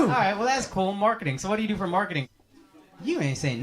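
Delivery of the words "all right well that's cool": usual speaking voice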